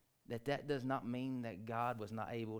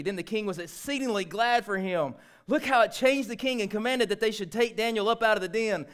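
loud, high scripture proclamation; full-force preaching; 7 semitones higher, 10 dB louder than usual. Real, not acted. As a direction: proclaim the rescue with fervour.

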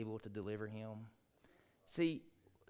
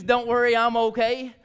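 loud, high shouted reassurance; Daniel; 9 semitones higher, 15 dB louder than usual. Acted. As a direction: call it out so the king hears. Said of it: "I know it means".

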